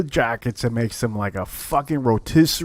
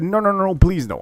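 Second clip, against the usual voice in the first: higher-pitched mock begging; desperate pleading; 7 semitones higher than usual. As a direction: act out a gambler begging in disbelief. Retold loser begs, acted higher.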